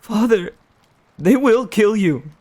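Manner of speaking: put-on high, loud panicked boy's voice; a son terrified for his father; frightened boy. The boy warns his father in panic, high and loud.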